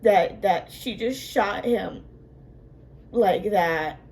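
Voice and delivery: normal talking voice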